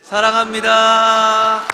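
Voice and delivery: long, high, drawn-out shout; full-volume, high group cheer; big loud voice. Real, not acted. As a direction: shout it out with the whole congregation. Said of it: "큰 목소리로".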